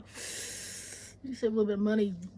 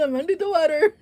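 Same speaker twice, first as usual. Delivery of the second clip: higher, louder whiny meme voice; TikTok voice; 6 semitones higher, 6 dB louder than usual. Retold or imitated. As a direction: wail it like the TikTok. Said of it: "I don't know if you see that TikTok".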